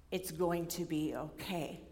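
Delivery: soft, slow reassuring tone; calm reassurance. Retold or imitated. Advisor calmly reassuring anxious student, soft and slow. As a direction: gently reassure her, unhurried. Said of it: "little smile on his face".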